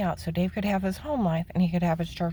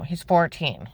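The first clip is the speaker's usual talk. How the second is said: usual speaking voice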